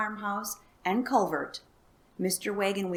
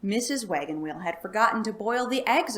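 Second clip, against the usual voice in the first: ordinary delivery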